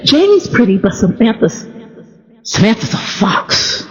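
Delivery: a hushed, airy grandma on the fox part; breathy grandma. Retold or imitated. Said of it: "Do you hear the breathiness in that last statement".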